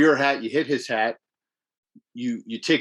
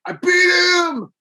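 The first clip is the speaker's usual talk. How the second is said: high, loud, drawn-out victory cry; triumphant shout; 6 dB louder, much slower than usual. Retold or imitated. Gamer retells beating the boss, with a high slow triumphant shout. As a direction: scream it like you finally won.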